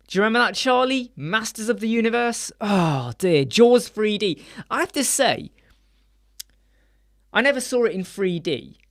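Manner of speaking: normal talking voice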